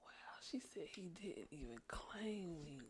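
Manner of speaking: slow mock-hurt whisper. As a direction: whisper it wounded.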